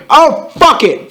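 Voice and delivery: loud, high, drawn-out outburst; angry shout. Acted. Vampire reacting to news of the shooting, angry shout. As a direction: burst out in hammed-up anger. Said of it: "Vampire shouted angrily".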